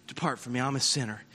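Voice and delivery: very soft, low plea of shame; quiet, ashamed confession; hushed confession. Acted. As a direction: murmur it like a broken man. Peter's confession quoted, hushed.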